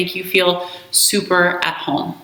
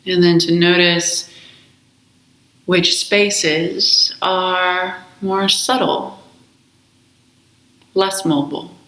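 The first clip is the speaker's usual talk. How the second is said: slow, calm meditation voice with long pauses; guided meditation; slower than usual. Acted. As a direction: guide them gently into the breath, unhurried. Leads a guided breath meditation.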